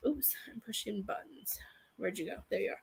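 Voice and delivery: quick private whisper; whispered aside. Real, not acted. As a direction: mutter to yourself while fixing the phone. Aside while fumbling the phone.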